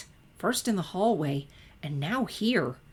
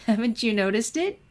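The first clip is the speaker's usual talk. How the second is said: giggling; 6 semitones higher, 5 dB louder than usual. A narrator's reading. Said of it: "still giggling".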